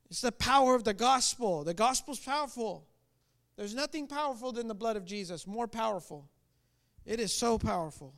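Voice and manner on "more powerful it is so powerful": ordinary talk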